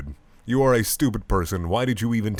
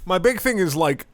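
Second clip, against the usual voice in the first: normal talking voice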